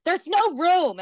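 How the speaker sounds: rude woman quote. Retold or imitated. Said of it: "And she was like".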